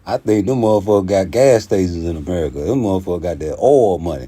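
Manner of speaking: normal talking voice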